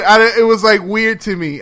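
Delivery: ordinary delivery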